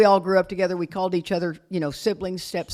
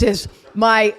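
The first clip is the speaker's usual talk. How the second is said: ordinary delivery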